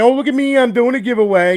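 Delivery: high, loud sneering mimicry of a lazy giveaway host; mock a showy cheap seller. Console builder mocking lazy giveaway makers, high sneering mimicry. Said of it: "some just thrown together thing saying".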